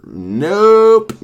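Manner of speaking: a high, loud burst of refusal; emphatic nope. Real, not acted. Refusing calls from random numbers, with a high, loud nope. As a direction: shut it down hard and loud. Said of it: "emphatic refusal".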